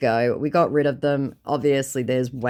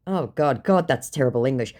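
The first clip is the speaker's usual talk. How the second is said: usual speaking voice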